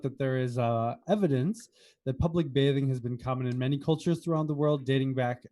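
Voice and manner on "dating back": normal talking voice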